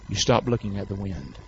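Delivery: low, soft instruction; quiet firm command. Real, not acted. Preacher counsels believers against Satan's blowing, quiet command. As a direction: bring the command right down.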